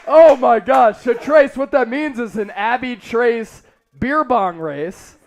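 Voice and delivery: ordinary talk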